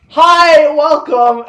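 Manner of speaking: loud, high customer service voice; fake cheerful greeting. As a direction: chirp a bright fake welcome at the counter. Mock cheerful greeting at work.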